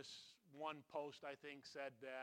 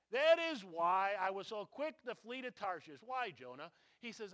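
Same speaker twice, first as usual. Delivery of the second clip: loud, driving preacher's voice; rising pulpit intensity; full-force preaching; 5 semitones higher, 10 dB louder than usual. Acted. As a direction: preach it out to the congregation.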